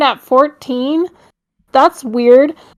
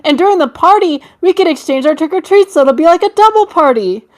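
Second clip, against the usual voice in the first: put-on childish girl voice; 6 semitones higher than usual. Reading kid's post in voice.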